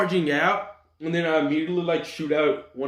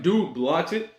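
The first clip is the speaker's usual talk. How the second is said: normal talking voice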